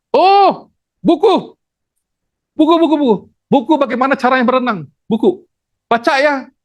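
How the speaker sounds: high-pitched, eager mock helper; acted passer-by. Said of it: "ada orang datang gitu". (pura-pura jadi penolong, nada tinggi)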